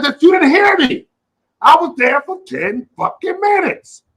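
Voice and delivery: loud, high angry yell at the worker. Retold or imitated. Customer yelling at drive-thru worker after long wait, loud and angry. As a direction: shout it out, fed up.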